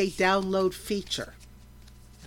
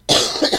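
chesty cough; 11 dB louder than usual. Real, not acted. Podcaster with a bad cough.

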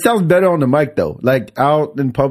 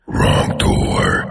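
breathy low whisper; creepy whisper voice. Shown as a demonstration. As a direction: hiss it like a ghost. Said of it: "But with that voice".

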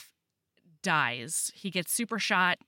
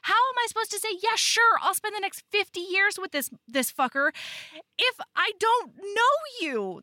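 much higher, slowed, exaggerated outrage; mock indignant heroine; 11 semitones higher than usual. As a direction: ham up her frustration in a high voice. She's like.

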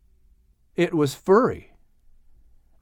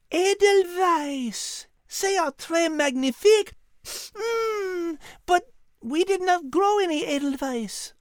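teary Liz; 3 dB quieter than usual. Acted. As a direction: marvel through tears. Liz moved to tears.

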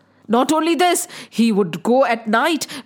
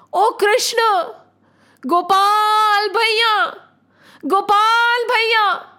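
high, slow, drawn-out cry for help; calling out; 6 semitones higher, much slower than usual. Acted. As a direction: call out to him from far away, slowly. Devotees crying out to Krishna.

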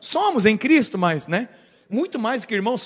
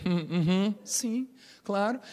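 evasive agreement; 10 dB quieter, slower than usual. Acted. Peter's evasive denial.

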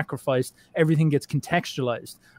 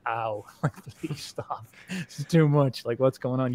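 despairing cry; slower than usual. Retold or imitated. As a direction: lightly act out begging the pain to stop. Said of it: "you cry out in despair".

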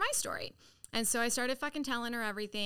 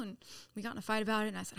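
ordinary talk, nothing marked

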